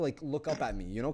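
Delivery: plain reading voice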